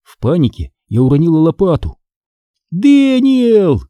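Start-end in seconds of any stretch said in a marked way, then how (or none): 2.7-3.8 very high, drawn-out call; shouting. A narrator's reading. Girl searching for her missing brother, high shout. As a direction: cry out the name, stretched long. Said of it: "изо всех сил крикнула я".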